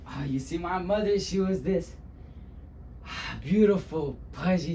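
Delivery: dramatic first-person storyteller; animated monologue with weighty pauses; [name]. Acted. Luis recalling his childhood to the audience, dramatic and animated. Monologue resumes after plain introduction.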